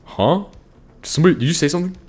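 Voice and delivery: high-pitched puzzled "huh?"; imitating someone who thinks they heard a voice; confused question. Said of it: "You'll be like".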